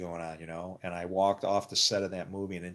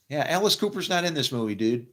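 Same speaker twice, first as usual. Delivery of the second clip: teasing quip; 6 semitones higher, 5 dB louder than usual. Retold or imitated. Makeup lady teasing.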